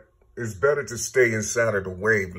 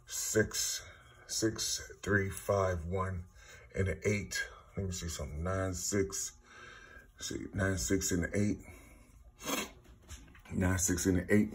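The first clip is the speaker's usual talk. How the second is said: muttered counting; 3 semitones lower, 11 dB quieter than usual. Real, not acted. Counting letters to himself.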